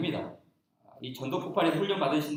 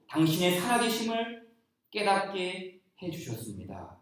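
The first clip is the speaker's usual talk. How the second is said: solemn reading; 4 semitones higher, 9 dB louder, slower than usual. Acted.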